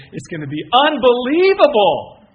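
high, loud burst on UNBELIEVABLE; exultant shout. Real, not acted. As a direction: build to a huge triumphant cry. Preacher climaxes his list of promises.

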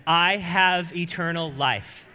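loud, slow, emphatic declaration; said like you mean it. Shown as a demonstration. Congregation told to declare it, loud and slow. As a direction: proclaim it with full conviction to your neighbor. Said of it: "now say it like you mean it".

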